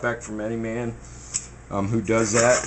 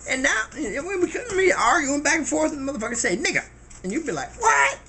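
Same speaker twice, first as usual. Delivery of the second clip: high, lively Pryor-style storytelling; Richard Pryor bit; 12 semitones higher than usual. Retold or imitated. Pryor telling how the argument turns, high and lively. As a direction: riff it like Pryor arguing back and forth. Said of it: "when Rich Pryor said".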